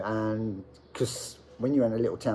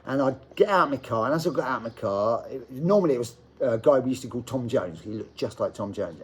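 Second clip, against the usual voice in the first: normal talking voice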